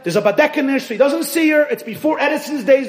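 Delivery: high, loud storyteller's build-up; preaching at full force. Acted. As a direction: sweep the crowd along, rising and loud.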